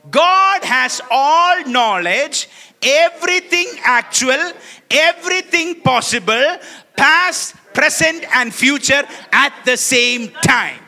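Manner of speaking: slow, loud unison reading; slow, loud group reading; read slowly. Shown as a demonstration. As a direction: lead the congregation word by word. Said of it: "Read it slowly and understand it".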